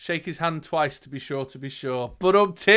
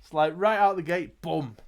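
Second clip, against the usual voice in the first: usual speaking voice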